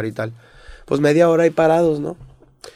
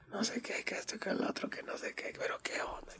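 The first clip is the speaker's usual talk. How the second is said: mock whispered yelling; 9 semitones higher, 21 dB quieter than usual. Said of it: "le empiezo a gritar".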